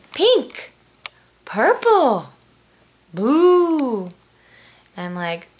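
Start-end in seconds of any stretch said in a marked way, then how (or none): 0.1-4.1 put-on high, loud child's voice calling out colors; toddler yelling colors. Two-year-old at the easel shouting his colors, high and loud. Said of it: "I hear him yelling".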